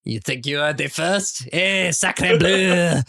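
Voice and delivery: hammed-up French accent, rising to a long drawn-out exclamation; mock French accent. Co-host answering the outrageous-accent jibe, mock French accent. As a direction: play an indignant Frenchman in a hammy French accent. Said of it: "What is this outrageous French accent?".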